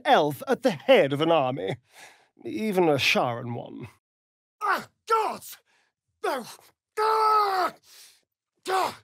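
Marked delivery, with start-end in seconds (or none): 4.6-5.6 high sharp cry of pain. Acted. Struck, crying out. 7.0-7.7 high sharp yelp of pain; pained cry. Acted. Struck, crying out.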